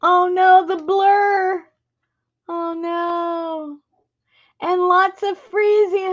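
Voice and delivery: high-pitched oh no; dismayed. Real, not acted. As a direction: react to the blurry video. Video blurring and freezing.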